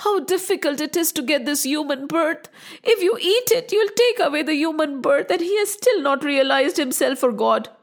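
high, fast pleading wife; high imploring wife reasoning with the demon. Acted. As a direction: urge the ogre to remember. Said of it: "she said to Saudas".

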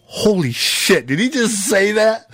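high-pitched retold shock; stunned disbelief. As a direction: react to your cousin, incredulous, pitch climbing. Stunned reaction to sheriff's words.

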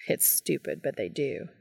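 low, hushed admission; Cass's gentle voice. A narrator's reading. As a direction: murmur it low and soft. Voices Cass in dialogue.

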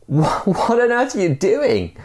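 stammered high-pitched mock shout; panicked cry from the bridge; storytelling shout. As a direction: call out in alarm, voice way up. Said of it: "And he shouts".

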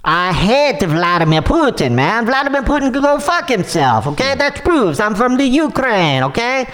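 put-on light Russian accent, pushy scammer; smooth-talking caller with a Russian accent. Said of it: "his Russian accent's coming out".

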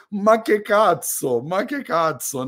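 slow exasperated mock Italian; jolly Italian pizza chef moaning; Italian accent. Italian exclamation after brand joke.

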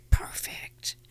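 it's perfect in a slow hushed whisper. Acted. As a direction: breathe it's perfect like a secret. Savouring the perfect crystal.

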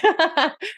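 light amused laugh; a quick real laugh; short laugh. Real, not acted. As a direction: laugh briefly at the joke. Laughing at her own joke.

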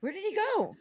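high, startled question; mock surprise. Friend vanished behind her.

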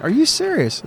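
high-pitched incredulous question; surprised. Retold or imitated. Telling the daughter about her father's ring, retold stunned surprise. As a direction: jump up high in sudden disbelief.

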